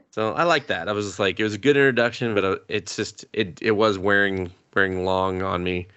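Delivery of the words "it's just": ordinary delivery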